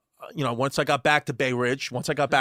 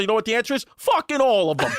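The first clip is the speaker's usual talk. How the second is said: high mimicked rant building to a punchline; Brooklyn mother; 6 semitones higher, 3 dB louder than usual. Friend's mom answering her own question, high emphatic mimicry. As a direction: imitate a feisty Brooklyn lady triumphantly. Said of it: "real deal Brooklyn lady smoking cigarettes".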